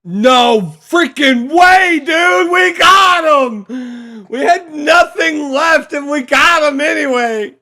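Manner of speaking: loud, high, slow triumphant shouts; excited shouting. Real, not acted. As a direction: cheer it like a winning goal. Cheering the bomb hit.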